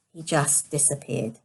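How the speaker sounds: usual speaking voice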